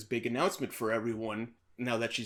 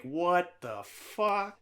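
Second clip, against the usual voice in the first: drawn-out, higher "what the fuck"; stunned disbelief; 6 semitones higher, much slower than usual. Retold or imitated. Teacher spots her one-night stand with his wife, stunned. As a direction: stretch each word in mock shock. Acting teacher's shock at seeing him.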